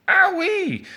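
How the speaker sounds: very high, loud yelp; cartoonish squeal of hurt; cry of pain. A narrator's reading. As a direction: yelp as if bopped on the head. Whacked on head with toy.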